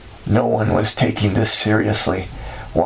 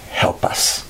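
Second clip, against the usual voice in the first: breathy unvoiced begging; whispered plea. Father kneeling by the angel, begging in a whisper. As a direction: whisper the last words of the prayer.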